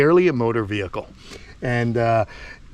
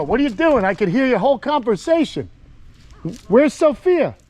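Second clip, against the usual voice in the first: raised voice, much higher, calling across the yard; 9 semitones higher than usual. Real, not acted. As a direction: call out to them so they hear you far off. Calling out to distant family.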